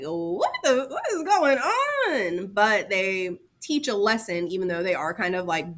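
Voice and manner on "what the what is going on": slow, confused what-is-going-on; drawn-out mock confusion; baffled. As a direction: react like a puzzled reader. Reacting to dark tales.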